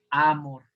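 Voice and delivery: amor stressed on the A, A-mor; deliberately misplaced stress, amor as Á-mor; stress on first syllable. Shown as a demonstration. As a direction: hit the opening A of amor, letting mor fall away. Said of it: "Ahora, en la primera".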